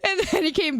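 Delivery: laughing through tears. Real, not acted. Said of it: "laugh cry".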